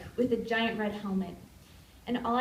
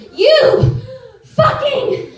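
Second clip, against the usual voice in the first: high, loud curse, a bit slower; enraged shout at a blur; furious retold yell; 14 semitones higher, 15 dB louder, much slower than usual. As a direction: scream it after the disappearing thief. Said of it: "and I yell at him".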